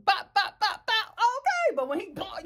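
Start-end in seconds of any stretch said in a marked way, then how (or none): 0.0-1.2 high bouncy "bop" in rhythm; rhythmic bop sounds. Shown as a demonstration. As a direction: bop along to a beat. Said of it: "Let me tell you".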